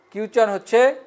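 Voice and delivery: normal talking voice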